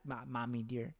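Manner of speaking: ordinary delivery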